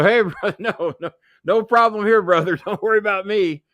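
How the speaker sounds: high, loud, laughing mock appeasement; high laughing brush-off; laughing placating. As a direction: laugh it off, playing along. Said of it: "So I'm playing along".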